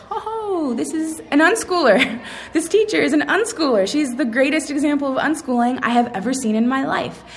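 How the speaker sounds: high-pitched awed discovery, laugh midway; delighted realization. Retold or imitated. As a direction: start on a soaring "oh" and gush with amazement. Retold moment of delighted realization.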